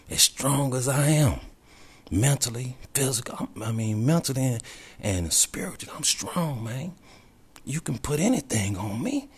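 high, strained declaration of strength while welling up; emotional insistence. Real, not acted.

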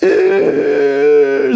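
mock crying whimper. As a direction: fake a little sob.

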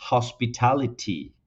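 British hospitality with a crisp, explosive t; hospitality British-style, sharp t's; British pronunciation. Shown as a demonstration. Teacher contrasting the British hospitality, explosive t. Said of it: "tienen una T más explosiva".

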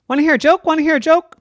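high, loud, eager little-sister voice. Acted. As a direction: pester them like a kid with a joke. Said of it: "Her little sister would come up".

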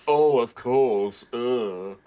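loud, drawn-out scornful groan; exasperated sarcasm. Real, not acted. Co-host scoffs at a studio's pandemic excuse, exasperated sarcasm. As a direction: drag it out loudly, fed up with the excuse.

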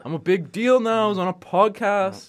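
high, loud, arrogant bragging; cocky bragging voice. Retold or imitated. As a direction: puff up like a big shot. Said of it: "You're gonna show up and just be like".

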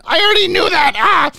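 high loud playful yell; shouting. Acted. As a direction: cry out with a startled shout.

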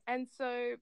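ordinary talk

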